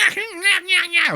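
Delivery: high cackling yeah yeah; laughing yeah yeah. Retold or imitated. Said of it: "cracked out version of Where's Waldo".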